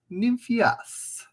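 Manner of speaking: high-pitched, botched French that turns Italian; badly faked French with an Italian lilt; Italian-sounding French. Shown as a demonstration. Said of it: "No, that's more Italian".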